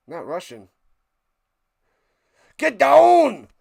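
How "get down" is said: loud, high action-hero yell; hammed-up accented shout; Arnold Schwarzenegger impression. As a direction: bellow it like an Austrian action star. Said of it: "Arnold Schwarzenegger to do a voiceover".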